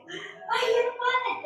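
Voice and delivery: high, pleading wail of a grieving aunt; retold desperate wail. Aunt retelling her wail to her sister, high and desperate. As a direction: cry out to her sister in anguish. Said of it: "Yo decía".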